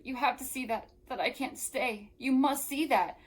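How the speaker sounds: ordinary delivery, nothing marked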